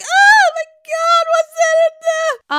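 hammed-up shriek, very high and loud; Candace. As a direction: imitate a ridiculous panicked squeal. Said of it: "Candace is ridiculous trying to open".

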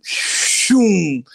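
long, falling "shoom" whoosh; whooshing puck noise. Retold or imitated. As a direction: imitate a puck whizzing past, drawn out. Imitates a puck flying past.

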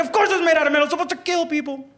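high-pitched mock yelling; exasperated drill sergeant. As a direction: yell the obvious at a clueless recruit. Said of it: "drill sergeants were yelling".

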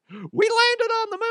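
mock amazed squeal; Dumb and Dumber impression. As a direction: imitate a movie dimwit's sudden awe. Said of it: "That line from Dumb and Dumber where he's all".

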